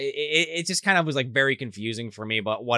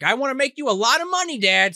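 lightly acted high-pitched plea; mock earnest appeal; pleading son; 10 semitones higher than usual. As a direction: plead with Dad in a raised voice. Acting son pleading with his dad.

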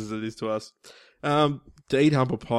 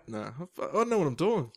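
ordinary talk, nothing marked

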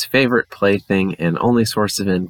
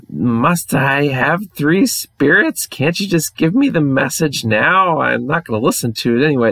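higher, thin pleading voice, shaking; frightened whine; 6 semitones higher than usual. Acted. Dolan pleading from his bed with the ghost, frightened whine. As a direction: beg the ghost to get it over with.

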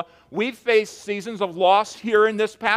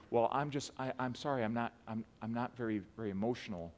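hesitant mourner; 7 semitones lower, 17 dB quieter, faster than usual. Retold or imitated. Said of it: "I'll g-, preach funerals sometimes".

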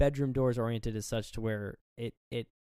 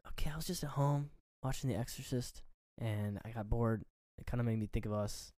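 softer, half-whispered imitation; hushed boyfriend, retold; 6 dB quieter than usual. Retelling boyfriend sweet-talking girl in her room, hushed imitation. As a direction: lightly voice a hushed sweet talker. Voicing Billy's pitch to girlfriend.

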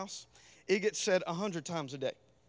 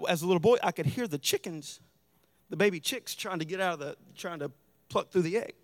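normal talking voice

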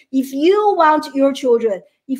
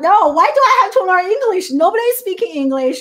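put-on childish protest; 6 semitones higher than usual. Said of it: "I was like".

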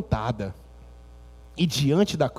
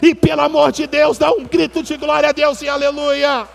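higher, louder preacher call to praise; fervent preaching; 9 semitones higher, 6 dB louder than usual. Acted. Preacher calling the church to shout glory, fervent and loud. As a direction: rouse the church to cry out glory.

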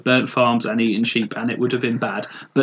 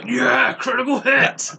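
excited gamer; 9 semitones higher, slower than usual. Retold or imitated. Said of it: "More of a".

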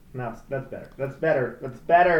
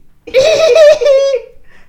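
very high, loud shrieking giggle; piercing imitation laugh; mock creepy laugh; 17 dB louder than usual. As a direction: cackle like a creepy assistant. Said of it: "Only if you laugh with me".

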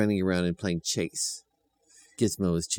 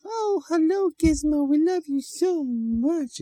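much higher and slow, fond; sweet children's voice; 5 dB louder, slower than usual. A narrator's reading. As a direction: coo at the puppy like a small child. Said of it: "the little boy and the little girl ... said".

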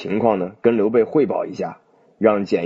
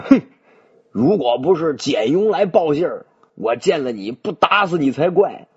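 a scornful snort, then a grudging threat; gruff and annoyed; 5 semitones higher than usual. A narrator's reading. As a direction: growl it out in bad temper. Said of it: "三爷没好气".

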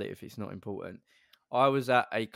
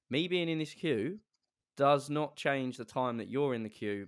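slow, over-articulated retort, pitched up at first; passive-aggressive articulate; 4 semitones higher, slower than usual. Retold or imitated. Retelling the reply to the man behind, passive-aggressive. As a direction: spell it out pointedly, word by word. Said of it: "being a bit articulate with him".

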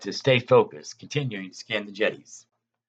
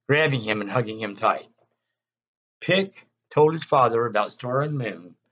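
usual speaking voice

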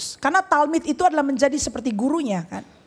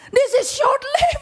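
much higher, half laughing, half crying protest; incredulous outcry; 8 semitones higher than usual. Retold or imitated. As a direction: cry it out in disbelief. Peter's disbelief acted out.

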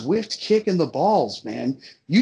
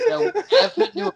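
laughing; 4 dB louder, faster than usual. Real, not acted. Mocking the plot, laughing.

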